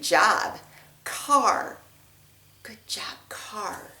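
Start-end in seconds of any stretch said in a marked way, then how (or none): 2.6-3.9 whispered praise, no voice at all. Real, not acted. Teacher whispering the word back to the child. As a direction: breathe the words softly.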